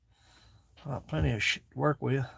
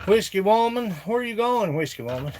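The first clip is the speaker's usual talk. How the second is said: higher, louder teasing call; playful call; 6 semitones higher, 4 dB louder than usual. Pretend. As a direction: sing out to a figure on the shelf. Sings out to figure.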